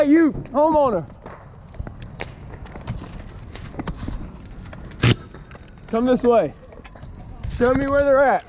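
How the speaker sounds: high, urgent shouting over noise. Real, not acted. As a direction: call out urgently to the homeowner. Calling homeowner at burning house.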